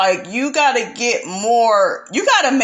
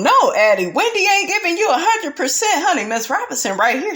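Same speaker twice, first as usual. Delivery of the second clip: usual speaking voice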